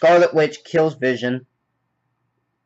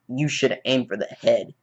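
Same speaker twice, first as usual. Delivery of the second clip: low, soft, measured delivery; understated menacing villain quote; slow villain line; 6 semitones lower, 6 dB quieter than usual. Retold or imitated. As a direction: quote it slowly, voice dropped low. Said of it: "He's like, slowly, Thanos is like".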